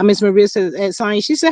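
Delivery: ordinary talk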